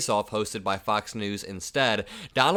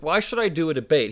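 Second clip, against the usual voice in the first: mock pout, higher and a bit louder; imitating a pouting, whining former president; pouting Donald Trump; 6 semitones higher, 4 dB louder than usual. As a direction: whine it like a sulking child. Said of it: "He's literally pouting".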